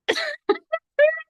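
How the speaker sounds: a high-pitched squeal of laughter. Real, not acted. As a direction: burst out in a shrill giggle. Laughing at her own joke.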